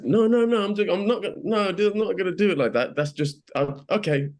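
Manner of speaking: high-pitched mock-panicked denial; flustered protest. Actor assuring the director about the accent on set, flustered. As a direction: squeak out a hasty, flustered backtrack.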